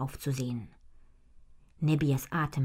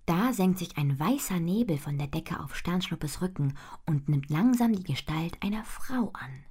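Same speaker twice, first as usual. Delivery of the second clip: usual speaking voice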